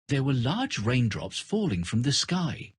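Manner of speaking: usual speaking voice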